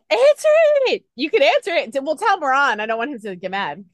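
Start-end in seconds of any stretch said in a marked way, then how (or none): 0.1-2.8 high, loud excited urging. Real, not acted. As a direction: urge her eagerly to pick up. Phone call interrupts interview.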